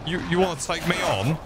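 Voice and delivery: high-pitched comic taunt; mocking, playful challenge; mock bravado.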